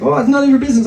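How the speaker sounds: lightly put-on Fiddler on the Roof accent, high. Thief brushing off a passer-by, high mock Fiddler on the Roof accent. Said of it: "the Fiddler on the Roof accent for the thief".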